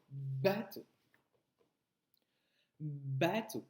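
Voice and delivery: "bateau" with a prevoiced, held b; low, stretched "baa" starting "bateau"; drawn-out initial B. Shown as a demonstration. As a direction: lean slowly into the b of "bateau". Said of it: "Par quel son débute".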